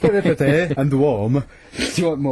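hammy fake British accent; bad British accent. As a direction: mimic a botched British accent, amused. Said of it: "what he thinks is a British accent".

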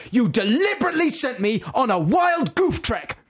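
high-pitched fuming outburst, played for laughs; hammed-up angry manager, voice jumping high; put-on high shout of outrage; indignant mock shout. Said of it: "He shouted".

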